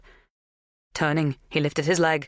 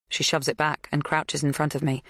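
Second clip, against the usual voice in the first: usual speaking voice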